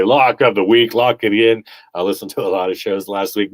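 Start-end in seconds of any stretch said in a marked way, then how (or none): 0.0-1.6 mock pundit hype. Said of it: "I heard so many people say".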